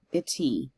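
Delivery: "Ity" with the weight on the ending "ee"; light first syllable, stressed "ee" in "Ity"; stress on final E. Shown as a demonstration. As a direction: lean on the last sound of "Ity". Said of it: "With the emphasis on the E part".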